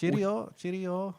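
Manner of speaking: high, bright mock-British cheerio; a fake British toff saying goodbye. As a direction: chirp it like a cartoon Brit. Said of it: "trying to do that with".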